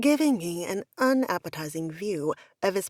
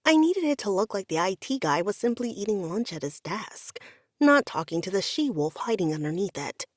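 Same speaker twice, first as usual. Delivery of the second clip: ordinary delivery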